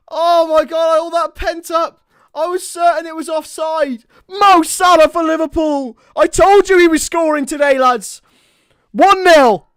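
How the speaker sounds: loud, high, half-crying with relief; roaring joy with a sob in it; real shouting celebration. Real, not acted. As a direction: let all the pent-up tension out. Celebrating the goal after the tension.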